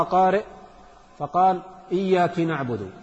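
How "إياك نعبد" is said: "إياك" said iyyaki, with i after the k; feminine address error. Shown as a demonstration. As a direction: misread "إياك" with kasra on the kaf. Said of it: "للمؤنث".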